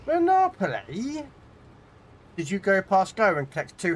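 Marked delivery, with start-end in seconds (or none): 0.1-1.2 high, slow, drawn-out incredulous Monopoly?; mock astonishment. Streamer teases a raider about playing Monopoly, mock amazed.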